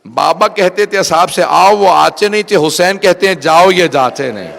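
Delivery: loud, impassioned grieving cry; retold tearful lament. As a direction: wail it out as the sister. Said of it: "رو کے کہا". (بلند آواز میں روتی فریاد)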